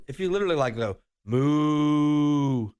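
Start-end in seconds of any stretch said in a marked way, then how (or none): 1.3-2.7 playful imitation of a cow's moo. Shown as a demonstration. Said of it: "I'm gonna do it".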